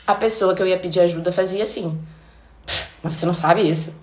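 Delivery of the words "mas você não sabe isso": lower, lightly mocking disbelief; a hint of a helper looking down on you; mild condescension. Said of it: "Nossa, já me senti um imbecil".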